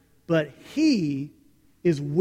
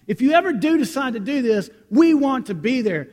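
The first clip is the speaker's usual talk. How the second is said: loud, higher mock urging; eager waiters; 7 semitones higher, 6 dB louder than usual. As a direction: tease him loudly like the waiters. Waiters' offer, retold.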